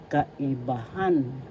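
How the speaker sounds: "Kaibahan" slow, weight on final HAN; accent on fourth syllable. Shown as a demonstration. Teacher demonstrating "Kaibahan" for learners, stressed last syllable. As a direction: say ka-i-ba-HAN slowly. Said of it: "The fourth syllable has the accent".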